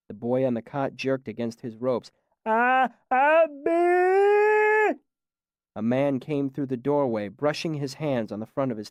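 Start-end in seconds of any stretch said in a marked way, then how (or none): 2.4-5.0 put-on high, loud strained shout of a tied boy; a desperate, animal-like call; wordless cry. A narrator's reading.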